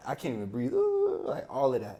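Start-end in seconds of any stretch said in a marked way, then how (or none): 0.7-1.2 much higher sobbing gasp; crying gasp. Retold or imitated. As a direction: gasp for air mid-sob. Imitating his crying gasp.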